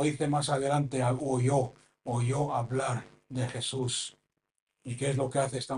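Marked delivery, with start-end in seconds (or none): none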